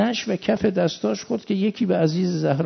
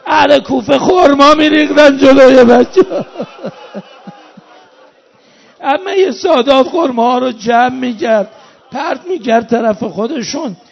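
loud, high, wailing preaching; full-force mourning lament; 5 semitones higher, 13 dB louder than usual. Acted. Preacher mourns Zeinab brought captive into Ali's city, loud wailing lament. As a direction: cry out the tragedy to a grieving congregation.

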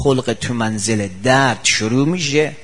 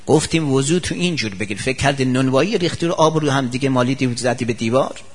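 ordinary talk

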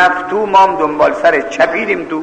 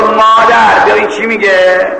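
moderately raised, higher call; calling for mother; 3 semitones higher than usual. Acted. Preacher imagines a girl calling mother, raised call. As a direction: call mother over, milder than a scream. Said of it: "اگر از دخترای ما بودن میگفت".

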